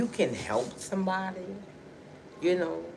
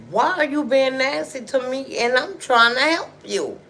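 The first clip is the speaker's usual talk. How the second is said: loud, high-pitched reproach; retold indignant confrontation; 10 semitones higher, 10 dB louder than usual. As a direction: call her out, hurt and indignant. Retold confrontation with ungrateful woman.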